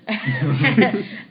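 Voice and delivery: loud delighted laugh; high happy laughter; burst of laughter. Real, not acted. Giggling about kicking butt.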